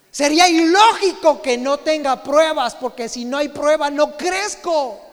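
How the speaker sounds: rising sermon fervor; fervent preaching. Real, not acted. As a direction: drive each word home to the congregation. Preaching with a laugh.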